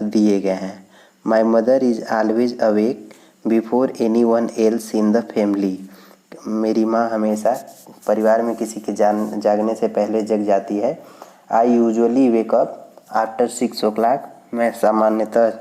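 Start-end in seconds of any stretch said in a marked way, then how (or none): 1.3-5.8 slow, careful classroom reading; slow read-aloud. Shown as a demonstration. Reads text slowly to class. 11.5-14.2 slow, careful classroom reading; slow read-aloud. Shown as a demonstration. Reads text slowly.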